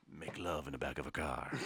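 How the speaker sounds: dropped low and soft, crooning; seductive low voice. Pretend. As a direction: croon it like a lounge lover. Sultry seductive lowering.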